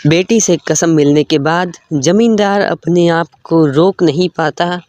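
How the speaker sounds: ordinary delivery, nothing marked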